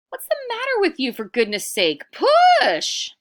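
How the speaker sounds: fast, very high, loud scolding ending in a shout; exasperated yell. Acted. Iktomi scolds the animals who gave up, rising to a shout. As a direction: scold them and bellow the last word.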